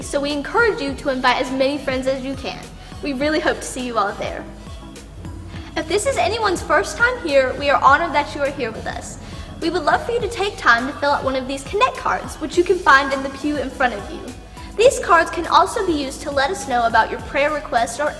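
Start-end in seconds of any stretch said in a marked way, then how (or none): none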